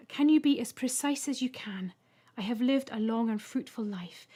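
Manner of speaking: whispered plea. Acted. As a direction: ask in a hushed whisper. Ethel pleads with Mip.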